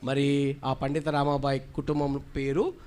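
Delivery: normal talking voice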